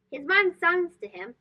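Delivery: ordinary delivery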